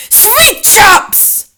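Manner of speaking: loud, high witch shout; high shouting foreign witch. A narrator's reading. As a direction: bark it out as the Grand High Witch. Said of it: "shouted the Grand High Witch".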